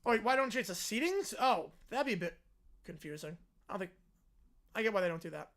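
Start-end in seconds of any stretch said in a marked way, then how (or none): none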